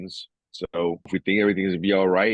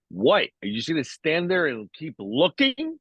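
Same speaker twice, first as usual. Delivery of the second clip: daughter scolding; 7 semitones higher than usual. Retold or imitated. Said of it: "my daughter goes".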